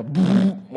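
buzzing lip-trill noise; short sputtering rumble-strip buzz; imitated rumble strip. Preacher showing the sound of rumble strips, imitated rumble. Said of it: "you know, that go".

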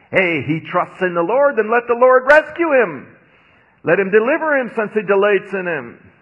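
mocking jeer. Preacher voicing the crowd mocking the sufferer, scornful jeer. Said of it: "All who see me mock me".

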